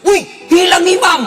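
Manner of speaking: very high, loud retold yell; mimicked high-pitched yell of alarm; startled shout. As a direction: act out a boy crying out in surprise. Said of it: "Langsung teriak".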